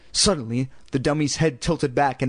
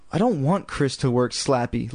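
plain reading voice

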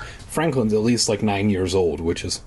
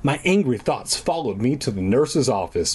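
usual speaking voice

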